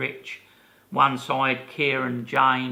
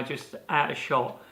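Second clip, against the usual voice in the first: ordinary delivery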